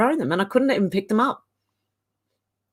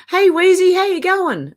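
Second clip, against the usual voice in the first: much higher and louder, quick delighted hello; fast excited hello; excited greeting; 11 semitones higher, 5 dB louder than usual. Real, not acted. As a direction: greet your friend like you just spotted them. Greeting a fellow streamer.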